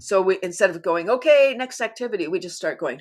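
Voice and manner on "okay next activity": higher, louder group announcement; mock activity-leader call. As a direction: call it out over a noisy room. Facilitator calling over loud group laughter.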